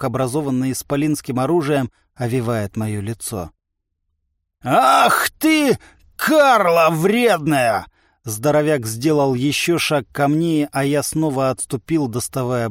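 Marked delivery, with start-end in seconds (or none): 4.6-7.9 high, loud, drawn-out curse; slow indignant roar; outraged giant. A narrator's reading. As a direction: bellow an insult while lunging. Outraged giant lunges at narrator.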